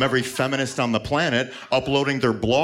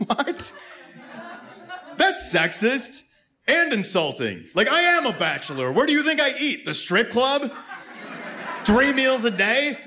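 much higher, indignant squeal; mock outrage at the idea; outraged disbelief; 12 semitones higher than usual. Real, not acted.

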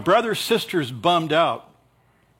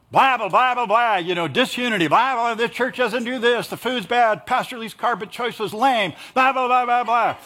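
mock complainer; 6 semitones higher than usual. Mocking a complaining churchgoer.

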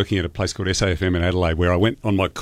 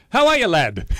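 put-on a failed try at a Liverpool accent; bad Liverpool accent; 10 semitones higher than usual. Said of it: "I can't do a Liverpool accent".

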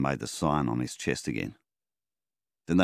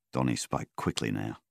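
ordinary delivery, nothing marked

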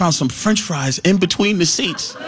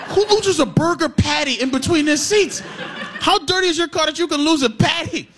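mock the friend in disbelief; incredulous; 7 semitones higher than usual.

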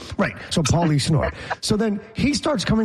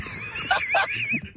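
big laugh; 6 dB quieter than usual. Real, not acted. Laughing at own story.

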